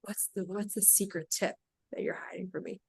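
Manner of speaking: hushed, leaning in to ask a secret; very quiet, curious parent leaning closer; conspiratorial hush. Retold or imitated. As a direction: ask it softly like sharing a secret. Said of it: "they kinda lean in like".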